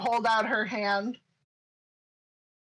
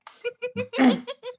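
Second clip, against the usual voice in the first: high laugh; 13 semitones higher, 4 dB louder than usual. Real, not acted.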